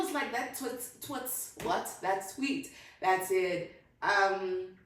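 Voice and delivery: ordinary talk, nothing marked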